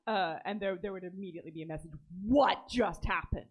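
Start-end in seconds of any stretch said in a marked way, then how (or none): 2.3-3.4 loud, drawn-out, higher-pitched disbelief; shocked outburst. Acted. Player voices the immediate message after the shock, shocked outburst. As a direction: blurt it out slowly and loudly, stunned.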